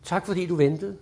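soft, gentle thank-you; warm thanks. Shown as a demonstration. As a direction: thank them softly and kindly. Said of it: "det her magiske ord".

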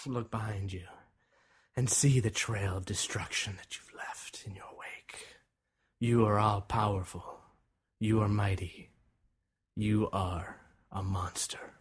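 low, soft hypnotic narration; ominous hush. Acted. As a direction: intone it low and measured. Solemn hypnotic climax of guided fantasy.

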